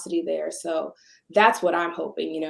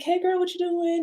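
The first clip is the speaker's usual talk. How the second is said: higher, breezy hello to a friend; light, upbeat check-in, pitched higher; casual greeting; 10 semitones higher than usual. Retold or imitated. Owner dropping in on a muralist, breezy and higher. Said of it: "and I was like".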